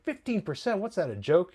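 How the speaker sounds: acted-out incredulous question; incredulous scoff. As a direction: imitate a haggler insulted by the offer. Acted haggling, scoffing at offer.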